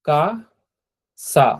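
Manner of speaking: কাসা drawn out slowly as ka-sa; slow model word. Shown as a demonstration. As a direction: stretch কাসা for students to repeat. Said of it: "এটা হচ্ছে কাসা". (কাসা ধীরে টেনে উচ্চারণ)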